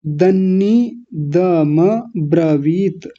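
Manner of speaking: दन्नी दम ब्रवीत very slowly, DAN-NI, DA-MA, BRA-VIT; broken into parts. Shown as a demonstration. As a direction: separate each piece of दन्नी दम ब्रवीत, drawn out. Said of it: "चार जगह तोड़ लीजिए". (दन्नी दम ब्रवीत धीरे-धीरे तोड़कर)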